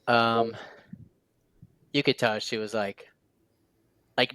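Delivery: normal talking voice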